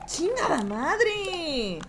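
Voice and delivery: very slow, stretched-out curse; drawn-out curse. Real, not acted. Streamer fed up with the game, slowly dragged curse. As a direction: drag the curse out long.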